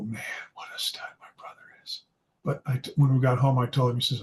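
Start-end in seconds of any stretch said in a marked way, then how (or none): 0.0-2.0 hushed whisper from behind a bush; an admiring whisper, unvoiced. Real, not acted. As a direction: breathe it out so nobody hears. Whispering while hiding behind bush.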